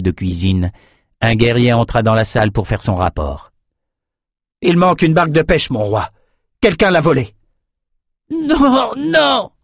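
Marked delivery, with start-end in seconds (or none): 4.6-7.3 higher, firm soldier voice; warrior's report. A narrator's reading. Warrior reporting missing boat to king, brisk. 8.3-9.5 very high desperate cry; cried out. A narrator's reading. Bleeding cook crying out to the king, panicked. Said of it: "s'écria Emmett".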